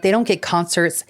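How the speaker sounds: ordinary talk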